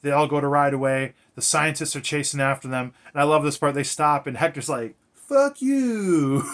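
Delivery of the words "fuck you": higher, louder taunting yell; mock taunt. Hector to the scientists chasing them, mock taunting yell. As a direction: fling it back at the chasers.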